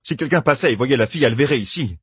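worried someone might see; urgent. Acted. Doessine warning the others, urgently. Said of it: "pressa Doessine".

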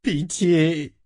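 high tearful cry for mercy; sobbing plea. A narrator's reading. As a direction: beg for mercy through acted sobs. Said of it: "sanglota-t-il".

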